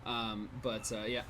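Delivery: normal talking voice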